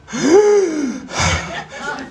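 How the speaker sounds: high, hammed-up horrified gasp; a mock gasp, laughing through it; mother's shocked gasp. Teacher imitating the boy's mother reacting, mock gasp. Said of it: "she goes".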